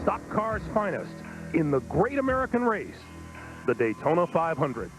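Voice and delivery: grand, sweeping race intro voice; booming announcer. Acted. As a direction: announce the great American race. Dramatic intro narration over music.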